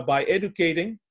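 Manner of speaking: ordinary talk, nothing marked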